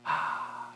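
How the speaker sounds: long audible breath out; deep sigh. Real, not acted. Tired preacher sighs with the congregation. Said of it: "everybody take a deep breath".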